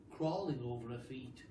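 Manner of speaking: usual speaking voice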